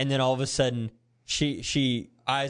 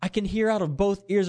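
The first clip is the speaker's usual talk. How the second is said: retold excited call in a much higher pitch; excited daughter; 8 semitones higher than usual. Girl tells her mom she can hear, excited, high-pitched. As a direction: imitate a girl bursting out with good news.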